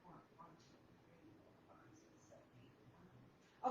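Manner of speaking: counting, heard from across the room; far from the mic. Real, not acted. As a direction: count quietly, walking away from the mic. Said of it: "I know y'all can still hear me".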